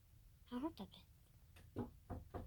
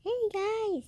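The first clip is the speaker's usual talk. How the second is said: much higher, loud hey guys; excited greeting; 10 semitones higher, 8 dB louder than usual. Acted. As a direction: burst in with a high, loud hello. Bright greeting to camera.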